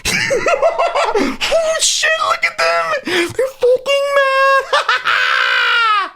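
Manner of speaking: very high, laughing mock cackle of a gloating troll; gleeful troll. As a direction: squeal it out like a delighted troll. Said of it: "and then you do this".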